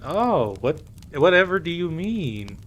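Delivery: high, coy feigned innocence; mock innocence. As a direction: act innocent, playfully. Mock innocence after being caught.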